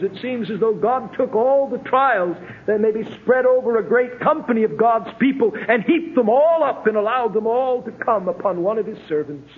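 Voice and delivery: ordinary talk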